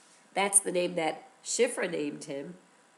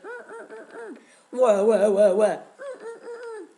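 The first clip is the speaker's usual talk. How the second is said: put-on baby refusing to feed; 10 semitones higher, 6 dB louder than usual. Baby Moshe refuses the nurse.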